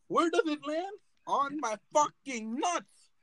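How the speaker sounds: high-pitched mock outrage; dramatic punchline. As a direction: draw out the painful climax, pitched high. Dramatic climax of the painful story.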